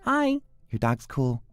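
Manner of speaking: put-on very high, shy child's voice; little girl voice. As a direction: pipe up like a small girl. Voicing his young daughter.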